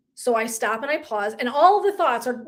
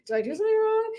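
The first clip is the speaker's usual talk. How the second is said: worried self-quote; 10 semitones higher, faster than usual. Retold or imitated. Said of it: "And I'm doing".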